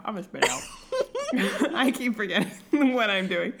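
laughing. Real, not acted. Laughing at own ice mishap.

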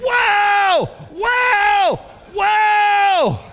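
high, loud drawn-out woe; angel's cry. Acted. Angel crying woe over the whole earth, loud and high. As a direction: wail it like a flying angel.

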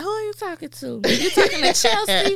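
put-on high-pitched little girl interrogation; imitating nosy child. Retold nosy daughter questioning.

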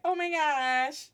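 high, drawn-out embarrassed oh my gosh; mock mortification. Student realizing her mistake, acting out high, slow embarrassment. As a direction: stretch it out in hammed-up dismay. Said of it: "I became totally flushed".

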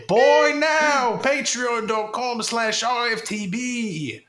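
high, slow mock Aussie wizard; Australian Harry Potter. Fake ad as Australian Harry Potter, accent. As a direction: ham up an Australian boy wizard. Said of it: "Australian Harry Potter".

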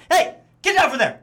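short, clipped stern dad call; staccato dad voice. Shown as a demonstration. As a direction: snap each word like a dad.

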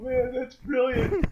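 high, drawn-out words through laughter; real amused, laughing delivery. Real, not acted. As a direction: barely get it out for laughing. Cracking up at co-host's story.

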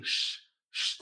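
unvoiced SH, like a shush; hushing sh. Shown as a demonstration. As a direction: hush through SH with no vowel. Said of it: "es así".

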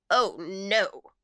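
loud, possessive refusal; emphatic jealous protest. A narrator's reading. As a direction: refuse jealously, guarding what is yours. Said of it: "was the jealous answer".

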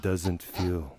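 hushed, low seductive whisper; sexy whisper. Acted. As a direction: breathe it out seductively. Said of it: "I think this needs to be a segment".

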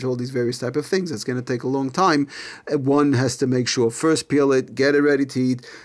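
normal talking voice

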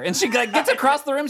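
ordinary delivery

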